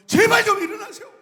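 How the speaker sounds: high loud shout; desperate plea. Acted. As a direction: beg him to wake up. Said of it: "부르짖으며 주님을 깨웁니다".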